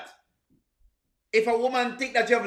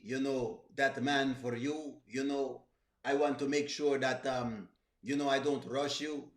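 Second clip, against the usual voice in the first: mock-sincere lover's reassurance, lower and softer; imitating Victor; 8 semitones lower, 6 dB quieter than usual. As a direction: play the patient gentleman sweet-talking Amber, low and hushed. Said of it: "Telling Amber about".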